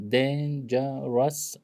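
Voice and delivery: "Dangerous," said slowly so its three syllables are heard; slow syllable demo. Shown as a demonstration. Teacher slowly sounds out "Dangerous," to count its syllables. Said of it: "also three syllables".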